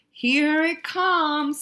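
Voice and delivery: high, loud and very slow; slow build-up. Acted. Skunk announcing his trap, slow and loud. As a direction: draw it out as the trap springs.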